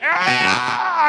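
very high, loud raging shriek; imitated demon scream. Devil raging at the pastor, long high imitated scream. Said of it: "But that thing went".

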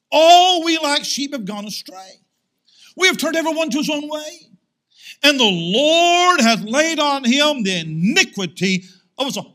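loud, ringing Bible reading; forceful scripture reading. Acted. Preacher reads the verses on Christ's atonement aloud, loud and ringing. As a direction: proclaim each verse to the hall.

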